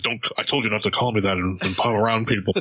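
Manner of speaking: low, stern rebuke; low stern warning to his girlfriend; stern villain. Retold or imitated. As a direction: scold her in a deep, stern voice. Stern villain voiced in retelling.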